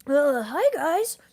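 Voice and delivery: high, loud put-on greeting; glasses-off voice. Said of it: "have a different voice".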